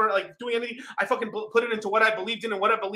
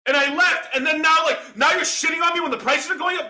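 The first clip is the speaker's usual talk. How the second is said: higher, louder angry shouting; furious rant; 5 semitones higher, 4 dB louder than usual. Real, not acted. Ranting at crypto YouTubers, higher angry shout. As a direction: yell it at the hypocrites.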